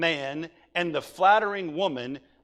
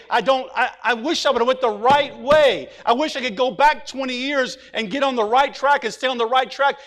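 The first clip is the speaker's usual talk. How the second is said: regretful sinner; 6 semitones higher, 7 dB louder than usual. Retold or imitated. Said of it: "they're looking back and saying".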